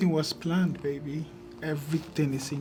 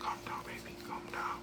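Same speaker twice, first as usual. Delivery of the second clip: soft whispered comfort; hushed soothing; 14 dB quieter than usual. Acted. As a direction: whisper gently to calm someone crying. Consoling the weeping listener.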